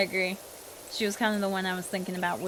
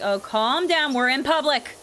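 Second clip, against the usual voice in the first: teasing; 5 semitones higher, 6 dB louder than usual. Retold or imitated. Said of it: "I'd be like".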